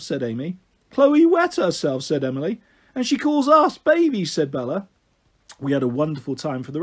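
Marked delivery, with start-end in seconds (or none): 0.9-2.0 high, loud, slow disbelief; scandalised. A narrator's reading. Child gleefully shocked at news. 2.9-4.3 put-on high, loud outraged child; indignant disbelief. A narrator's reading.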